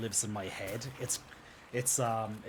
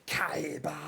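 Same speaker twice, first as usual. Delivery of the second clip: Yugi retort; 4 semitones higher than usual. Retold or imitated. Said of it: "how Yugi usually retorts when he says Kaiba".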